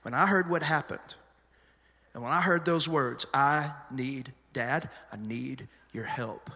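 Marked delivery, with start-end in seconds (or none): 3.3-6.5 slow, soft and shaky, almost breaking; trembling plea. Retold or imitated. Son fighting back tears begging dad for help, slow and soft. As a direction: barely hold back tears on each word. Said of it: "when I heard him fighting back tears".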